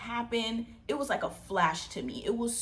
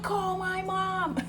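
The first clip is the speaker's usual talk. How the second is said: high, drawn-out mock wail; urgent cry; 13 semitones higher, 3 dB louder, much slower than usual. Bitten girl to her cousin, wailing for her mom. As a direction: re-enact a desperate plea, stretching the words. Retold cry for help while bleeding.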